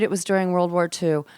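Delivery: ordinary talk, nothing marked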